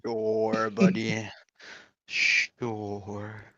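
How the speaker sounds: unimpressed sarcasm; sarcastic. Real, not acted. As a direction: dismiss him dryly. Sarcastic dismissal of boast.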